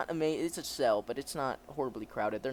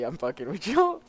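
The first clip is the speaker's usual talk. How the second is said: ordinary delivery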